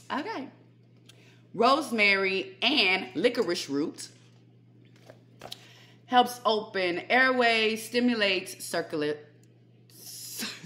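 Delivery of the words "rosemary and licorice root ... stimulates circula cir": slow, deliberate read-through of written notes; reading notes aloud. Acted. Reading her written bronchitis notes to viewers, slow and careful.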